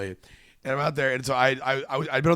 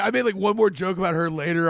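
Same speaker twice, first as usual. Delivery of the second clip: ordinary delivery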